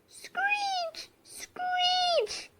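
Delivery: high breathy whispered screech; owl screech. Acted. Olly Owl screeching as he flies over, high whispered screech.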